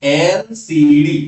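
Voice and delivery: "એલ સી ડી" spelled out letter by letter, slow and loud; pronunciation demo. Shown as a demonstration. Teacher shows students how the abbreviation is pronounced, slow pronunciation demo. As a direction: say "એલ સી ડી" slowly, each letter sounded out. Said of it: "આનો ઉચ્ચાર શું થશે અહીંયા".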